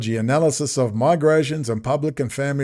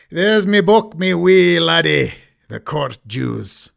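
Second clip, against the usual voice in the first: louder hammed-up Scottish brogue; mock Scottish accent; 2 semitones higher, 4 dB louder, slower than usual. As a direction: play a jokey Scotsman talking to the lads. Mock Scottish on finding book.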